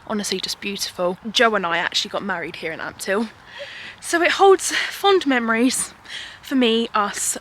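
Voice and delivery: ordinary talk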